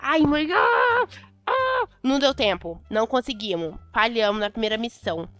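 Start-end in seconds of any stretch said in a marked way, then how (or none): 0.5-1.9 high shrieking scream of fright; startled scream. Real, not acted. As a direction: let out two sharp, high screams. Spider ban, sudden fright. (gritos agudos de susto)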